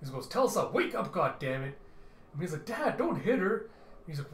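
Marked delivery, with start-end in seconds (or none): 0.3-1.7 frustrated raised voice; urgent shout. Acted. Slapping a drunk to wake her. 2.7-3.6 put-on high, loud child's protest; upset pitched-up kid voice. Child protests at father's slapping.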